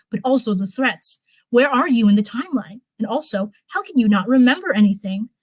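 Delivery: plain reading voice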